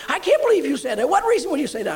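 high, loud, wounded protest; hurt indignation. Retold or imitated. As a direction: demand a reason, deeply offended. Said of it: "She started crying".

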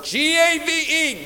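G A V E spelled letter by letter, slow and high; spelled out slowly. Shown as a demonstration. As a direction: spell out G A V E slowly, each letter its own beat. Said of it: "just circle that word".

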